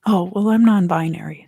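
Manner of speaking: usual speaking voice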